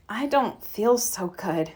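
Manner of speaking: slow, soft, croaky and worn out; low croaky voice. A narrator's reading. Mama tells Papa she feels ill, slow, soft and croaky. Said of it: "in a low, croaky sort of voice".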